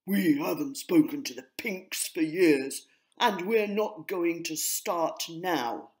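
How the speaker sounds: grey pigeon crossly refusing peace. A narrator's reading. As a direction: slam the door on the idea. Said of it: "Po said crossly".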